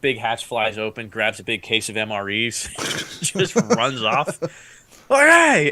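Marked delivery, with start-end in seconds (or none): 3.2-4.4 laughing through the words. Real, not acted. Laughs while telling the theft. 5.1-5.7 loud, high mock outburst; exasperated shout. Acts out his reaction to theft.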